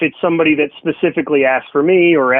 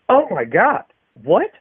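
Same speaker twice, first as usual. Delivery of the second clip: shocked customer; 8 semitones higher, slower than usual. Retold or imitated.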